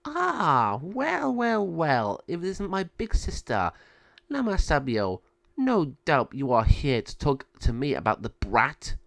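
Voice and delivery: smug, high villain voice greeting her sister; a sly sneer at the sister. Acted. Villain mocking her big sister, smug and high.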